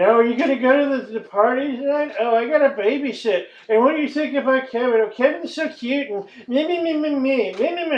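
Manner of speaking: high, fast mocking girly voice; mocking chatty teen girls who only talk about themselves; mock teen girl chatter. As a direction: ham up giddy gossiping teen girls. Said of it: "a couple of over-caffeinated high school girls".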